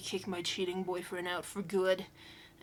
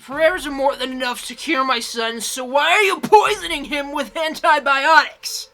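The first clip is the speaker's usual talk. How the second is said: furious shouted accusation; angry yelling; 4 semitones higher, 12 dB louder than usual. Acted. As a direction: yell it at the priest. Intro clip of mother screaming at priest.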